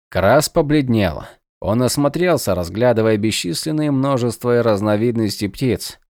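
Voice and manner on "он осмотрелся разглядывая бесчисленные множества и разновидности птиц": ordinary delivery, nothing marked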